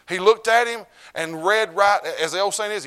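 high, loud and stretched out; slow loud preaching. Acted. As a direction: proclaim it slowly with power. Preaching climax.

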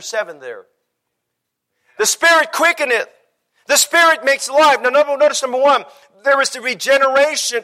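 high-pitched, urgent sermon voice; fervent preaching. Real, not acted. Preacher insisting to the congregation that salvation is a gift, fervent. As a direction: drive the point home to the whole church.

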